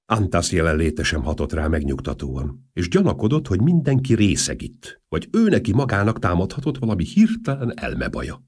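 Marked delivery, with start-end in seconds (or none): none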